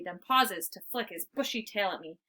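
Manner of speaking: plain reading voice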